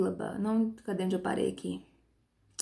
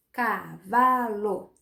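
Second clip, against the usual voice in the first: "cavalo" very slowly as ca, va, lo; three drawn-out beats in "cavalo"; syllable by syllable; much slower than usual. Shown as a demonstration. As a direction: stretch and split "cavalo" for counting. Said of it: "Três sílabas".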